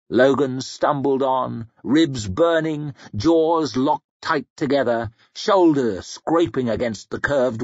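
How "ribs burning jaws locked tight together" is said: ordinary delivery, nothing marked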